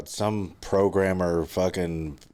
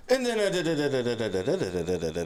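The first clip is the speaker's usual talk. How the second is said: mock breathless babbling; 5 semitones higher than usual. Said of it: "then I'd run out of breath".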